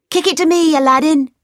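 high, eager call across the street. Acted. Friend calls to Aladdin in street football, calling out. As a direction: shout for the ball, pitched high.

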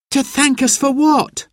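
put-on high, baffled child's question; confused child. A narrator's reading. Boy questions Mum about thanks, confused, high. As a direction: ask it pitched right up, bewildered.

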